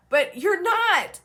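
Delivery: "not" drawn out high and loud; high, loud, falling stress on "not"; emphatic insistence. Real, not acted. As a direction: insist slowly, landing hard on "not". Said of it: "I'm emphasizing this in this way".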